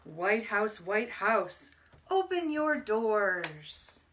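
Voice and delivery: ordinary talk, nothing marked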